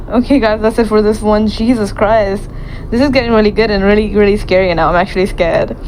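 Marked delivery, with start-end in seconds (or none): none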